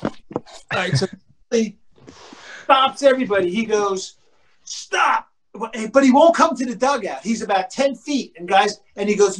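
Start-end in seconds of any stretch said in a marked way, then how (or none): 4.6-5.3 high, halting call-out; imitated command. Teammate halting everybody after his home run, imitated command. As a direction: stop everyone in their tracks, pitched up.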